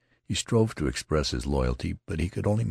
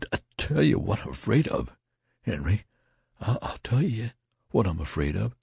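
high-pitched, voiced stage whisper full of fear; shaking, fearful whisper; 7 semitones higher than usual. A narrator's reading. Terrified soldier confiding in his friend, shaking stage whisper. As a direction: confide the terror, voice climbing higher. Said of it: "began to speak in a shaking whisper".

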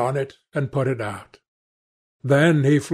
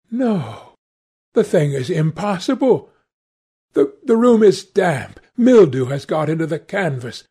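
raised-pitch, agitated denial; distraught Hallward; 4 semitones higher than usual. Acted. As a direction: insist against the evidence, pitch lifted.

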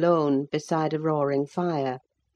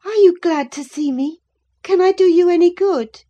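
put-on higher, louder, eager young girl; 8 dB louder than usual. A narrator's reading. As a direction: reach out to the sick cousin hopefully. Catherine eagerly greets Linton.

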